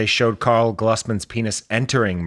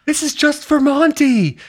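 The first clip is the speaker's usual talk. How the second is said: high puppet voice; 5 dB louder than usual. Pretend.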